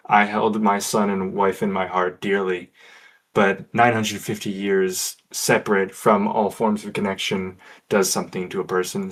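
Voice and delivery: ordinary talk, nothing marked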